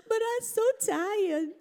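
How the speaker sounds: put-on high, slow whiny little kid; fake toddler whine. Grandson faking tiredness to get his way, high whiny voice. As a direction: whine like a two-year-old faking exhaustion. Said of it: "Fake whining and everything".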